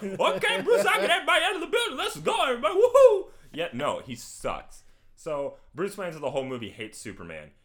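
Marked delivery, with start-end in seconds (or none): 0.0-3.3 very high, loud, gleeful caricature; idiot friend. Acted. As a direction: whoop like a happy dimwit. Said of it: "His friend was like".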